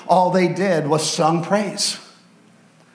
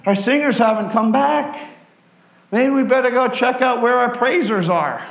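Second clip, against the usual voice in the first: higher, curious soldier voice; army of Judah; 6 semitones higher than usual. Retold or imitated. Judah's army deciding to check on the praisers, higher voice. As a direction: wonder where the singers went. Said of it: "And so they said".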